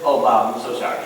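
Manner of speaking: low, trailing-off apology to a buddy; sheepish apology. Acted. Mock apology to embarrassed neighbour.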